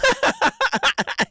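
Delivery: very high squealing laugh. Real, not acted. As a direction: burst out giggling. Laughs at pigeon joke.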